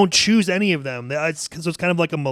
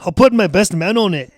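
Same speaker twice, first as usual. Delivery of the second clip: put-on Scottish brogue, a touch of anger; angry Scottish accent; 2 semitones higher, 4 dB louder than usual. McFiddish opening the table read, angry Scottish accent. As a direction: play an irritated Scotsman. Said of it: "gotta do a Scottish accent".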